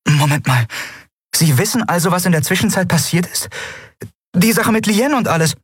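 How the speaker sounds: a cheeky captain teasing the eidolon; young woman Endriel. Acted. Endriel proposes teasingly to Yunnan, playful high voice.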